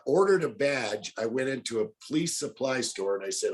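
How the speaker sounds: ordinary talk, nothing marked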